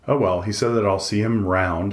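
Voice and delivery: ordinary delivery, nothing marked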